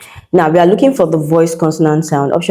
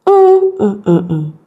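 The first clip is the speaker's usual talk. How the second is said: humming the word; 3 semitones higher, slower than usual. Shown as a demonstration. Teacher hums a word to check it sounds natural. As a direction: hum it through to test the sound. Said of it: "You need to hum the word".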